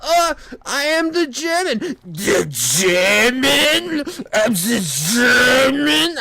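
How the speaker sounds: goofy, high, drawn-out mock German accent; Adam Sandler. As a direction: stretch it out in a silly German accent. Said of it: "Adam Sandler doing a German accent".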